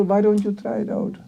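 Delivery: ordinary talk, nothing marked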